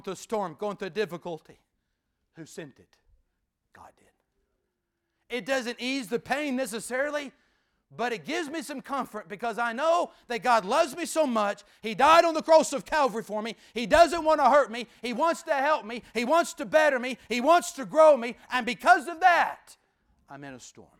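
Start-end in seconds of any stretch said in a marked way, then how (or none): 2.4-5.4 breathy hushed answer; hushed reveal; whispered answer. Acted. Answers own question softly. 5.4-19.8 loud, climbing sermon cry; shouted gospel fervor; preaching at full force. Acted.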